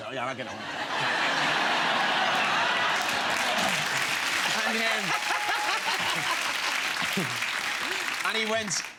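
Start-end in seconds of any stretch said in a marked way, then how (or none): 0.6-4.6 loud delighted burst of laughter; loud high laugh. Real, not acted. Retelling Scorsese's call to the host, loud high laughter. 4.9-8.2 loud high helpless laugh; high laughter. Real, not acted. Telling the host about the call, high helpless laughter.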